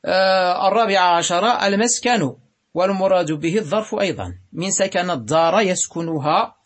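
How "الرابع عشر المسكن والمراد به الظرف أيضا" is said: ordinary talk, nothing marked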